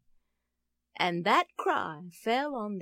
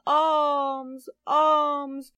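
drawn-out, higher-pitched plea for alms; beggar's cry; 4 semitones higher than usual. A narrator's reading. Blind beggar calls to passers-by, crying out. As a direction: cry out the words like a street beggar. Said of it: "sat there many days crying".

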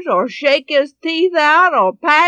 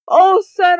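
higher, louder joyful exclamation; delighted mother; 7 semitones higher, 9 dB louder than usual. Acted. Mother delighted at finding her money, joyful call to her son. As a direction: gush with pleasure at the boy.

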